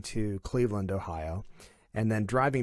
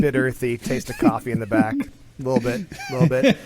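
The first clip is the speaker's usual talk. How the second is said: teasing laugh; 2 semitones higher, 8 dB louder than usual. Real, not acted. Joking about himself as beer.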